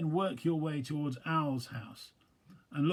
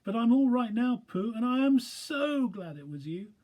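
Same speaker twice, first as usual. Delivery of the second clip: small high squeaky animal voice, relieved; tiny timid creature, glad it's a friend; Piglet; 11 semitones higher, 7 dB louder than usual. Acted. Piglet voice held.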